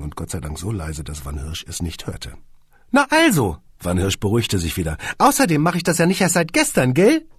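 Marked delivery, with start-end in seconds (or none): 2.9-3.6 high, loud and offended; indignant squeaky boss. A narrator's reading. Boss scolds Kunze, high and loud. 5.2-7.3 high and self-satisfied; smug squeaky boss. A narrator's reading. Boss boasts to the musicians, high and smug.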